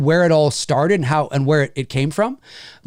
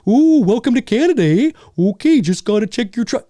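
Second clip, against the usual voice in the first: high, loud Canadian border guard caricature; cartoon Canadian accent; 6 semitones higher, 4 dB louder than usual. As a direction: ham up the Canadian accent, pitched way up. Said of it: "Nobody talks like that".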